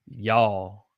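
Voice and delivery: y'all as one syllable, yawl; Southern y'all. Shown as a demonstration. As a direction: say y'all slow and long. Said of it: "Try it one more time".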